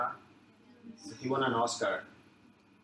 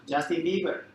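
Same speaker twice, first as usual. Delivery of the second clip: excited name; 6 semitones higher, 6 dB louder than usual. Real, not acted. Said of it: "Who do you know now?".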